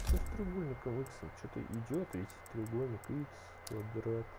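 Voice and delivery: ordinary delivery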